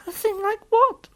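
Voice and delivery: put-on high, loud child's question; puzzled little girl. Lucy pressing the faun to explain, high and puzzled. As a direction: pipe up as a girl wanting answers.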